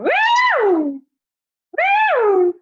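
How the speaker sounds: very high, loud woo; high whooping voice thrown up; really high woo. Shown as a demonstration. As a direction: fling the voice up high. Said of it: "throw it really high".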